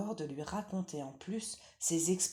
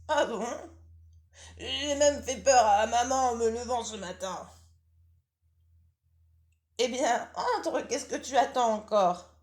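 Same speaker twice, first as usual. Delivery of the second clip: lightly higher, louder granny voice; old grandmother; 7 semitones higher, 9 dB louder than usual. Acted. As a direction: call out as a drowsy old granny from bed. Grandmother replies.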